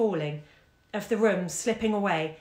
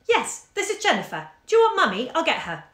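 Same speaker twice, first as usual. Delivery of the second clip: put-on high, slightly over-loud little girl; careful child answering the phone, pitched up; child's voice; 9 semitones higher, 6 dB louder than usual. A narrator's reading. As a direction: pipe up high, a touch too loud. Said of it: "When a child's voice, high and carefully a little over-loud".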